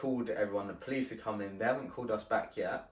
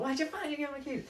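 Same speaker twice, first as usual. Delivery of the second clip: much higher, fast, mock-indignant girlfriend voice; much faster than usual. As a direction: squeak it out like an outraged partner. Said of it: "She was like".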